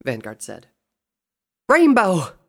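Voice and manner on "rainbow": high, loud cry of a name; calling out. A narrator's reading. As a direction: call out to a found friend. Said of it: "Applejack called out".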